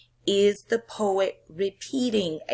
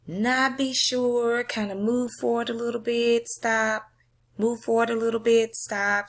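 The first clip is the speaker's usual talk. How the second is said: demonstrating teetering; 4 semitones higher than usual. Shown as a demonstration. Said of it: "what it means to teeter".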